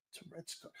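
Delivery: whispered in church. Retold or imitated. Whispering at a funeral in church. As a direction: breathe it without voice. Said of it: "I'm sitting there going".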